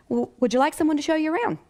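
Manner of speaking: high, quick imitation of a pub fella; retold chatty invitation; eager offer. Fella offers to show them around.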